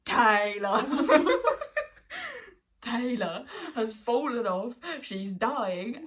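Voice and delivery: loud, slow words through laughter; laughing plea. Retold or imitated. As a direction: laugh while pleading. Laughing retelling own plea.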